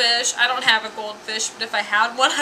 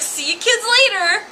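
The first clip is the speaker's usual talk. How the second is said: high, loud sing-out goodbye; breezy mom; 10 semitones higher, 5 dB louder than usual. Retold or imitated. Mom leaving the kids alone, mock breezy. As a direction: imitate a mom calling a carefree farewell. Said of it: "She's like".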